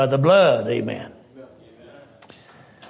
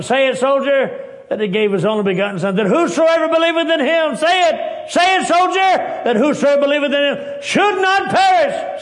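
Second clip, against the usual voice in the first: high, loud, fervent urging build; preaching shout; 12 semitones higher, 6 dB louder than usual. Acted. Preacher shouting the verse as Master Ward urges the soldier. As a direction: climb to a full shout at the climax.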